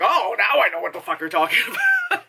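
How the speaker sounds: fast, high, breaking into laughter; laughing mock recognition. Imitating phone staff catching on, laughing mock realization. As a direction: rush it out as it clicks, laughing.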